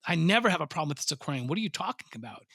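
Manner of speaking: a hint of a confused fish guy; retold shock. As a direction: act out the baffled outburst. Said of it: "And I was like".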